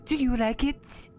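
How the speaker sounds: a hint of sarcastic elf; sarcastic dark elf. Shown as a demonstration. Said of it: "Does it sound sarcastic enough".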